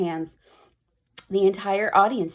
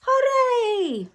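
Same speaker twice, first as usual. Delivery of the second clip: high triumphant cheer; witches shouting. Acted. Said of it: "all of us witches shout".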